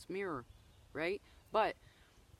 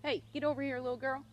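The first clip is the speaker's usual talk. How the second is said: calling the dog; 7 semitones higher, faster than usual. Real, not acted.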